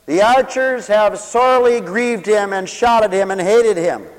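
loud, high-pitched pulpit proclamation; full-force preaching. Real, not acted.

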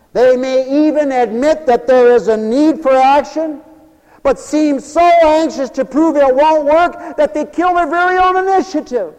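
loud, high, soaring preacher's cry; preaching at full force. Acted. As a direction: preach it out to the whole hall.

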